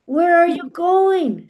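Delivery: high, loud, drawn-out demand; calling after him. A narrator's reading. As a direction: call out indignantly to a husband walking off. Wife calls after departing husband.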